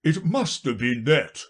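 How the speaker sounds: forceful king's verdict; reasoning king. Acted. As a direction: pronounce it as a storybook king. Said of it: "said the king".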